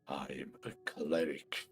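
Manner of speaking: low, slow pained whisper; strained whisper. Pretend. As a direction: force the words out through the hurt. Injured cleric insists through pain.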